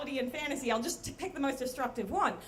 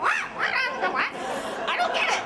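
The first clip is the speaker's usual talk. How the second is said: very high, loud squeal of denial; hammed-up bafflement; 12 semitones higher, 5 dB louder than usual. Narrator told she looks like the crypt keeper, hammed-up bafflement. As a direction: squawk it in mock confusion.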